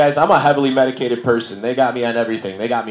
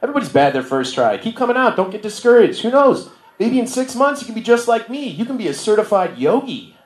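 much higher, sing-song encouraging instructor voice; mock chirpy yoga teacher; 8 semitones higher than usual. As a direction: play the relentlessly upbeat yoga teacher cheering on a beginner. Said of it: "They're like".

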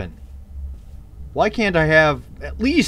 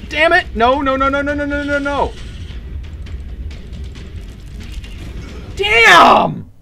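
panicked yelling; 10 semitones higher than usual. Real, not acted. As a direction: plead as the hits land. About to die in game.

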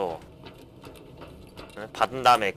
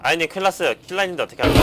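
sudden raised alarmed voice; urgent, higher and louder; alarmed gamer; 6 semitones higher, 5 dB louder, faster than usual. Real, not acted. Spots Killa boss, alarmed.

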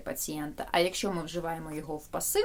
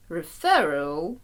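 ordinary talk, nothing marked

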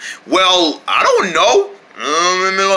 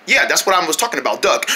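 usual speaking voice

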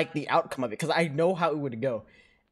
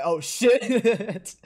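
startled; 9 semitones higher, 8 dB louder than usual. Retold or imitated. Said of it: "I'm like".

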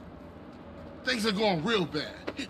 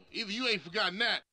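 ordinary talk

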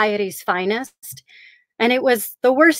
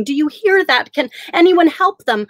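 higher, louder calling for help; 6 semitones higher, 4 dB louder than usual. Acted. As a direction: call out urgently to passers-by. Calling out to the living.

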